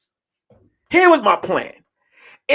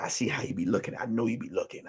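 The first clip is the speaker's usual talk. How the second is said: low, very soft retold reply; knowing murmur; 9 semitones lower, 14 dB quieter, faster than usual. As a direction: imitate her quietly calling him out. Teresa's private reply, imitated.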